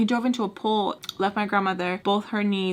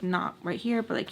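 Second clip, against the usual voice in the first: ordinary talk, nothing marked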